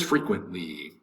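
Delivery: "frequently" with the T nearly dropped; disappearing T. Shown as a demonstration. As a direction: say "frequently", barely sounding its T. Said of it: "The T kind of disappears".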